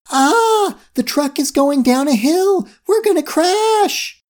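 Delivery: very high, panicky scream; screamed alarm. A narrator's reading. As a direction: squeal in terror, drawing out the end. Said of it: "looked out of the window and screamed".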